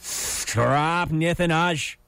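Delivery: Scrapnithanaj said slowly, sound by sound, his name reversed; backwards name. Shown as a demonstration. Host demos his name backwards to co-host, slow and careful. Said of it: "trying to say my name backwards".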